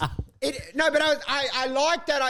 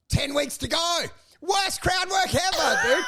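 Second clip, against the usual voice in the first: mock shout; 8 semitones higher than usual. Real, not acted. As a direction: yell it in disbelief at the worst gig. Comic outrage at bad gig.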